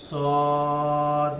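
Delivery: সদ said with rounded lips, full and heavy; rounded mouth. Shown as a demonstration. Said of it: "মুখটা একটু গোল করে পড়তে হবে". (সদ মুখ গোল করে, ভারী উচ্চারণে)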